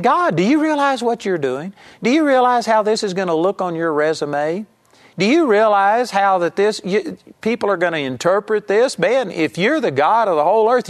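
incredulous, high-pitched reproach; scolding God. Retold or imitated. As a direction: scold as if lecturing someone above you. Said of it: "he's scolding God and saying".

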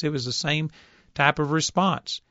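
ordinary talk, nothing marked

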